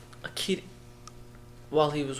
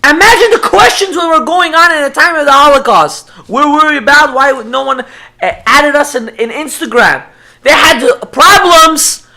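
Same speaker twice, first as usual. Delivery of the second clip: loud, high, impassioned preaching; preaching at full force; 9 semitones higher, 14 dB louder than usual. Real, not acted. Preacher presses listeners on Holocaust dilemmas, preaching at full force. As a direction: hammer the questions home to the congregation.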